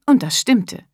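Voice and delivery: ordinary delivery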